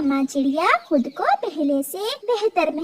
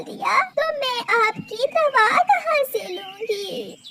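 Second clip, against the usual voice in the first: much higher, tearful despairing plea; tearful little bird; 8 semitones higher than usual. Acted. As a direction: cry out to your mother in despair. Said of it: "गुड़िया रो रही थी".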